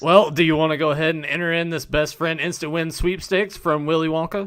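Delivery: loud, high hyped ad read; booming prize announcer. Pretend. Reading sweepstakes ad aloud.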